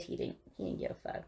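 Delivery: low muttered aside, fast; muttering under breath. Real, not acted.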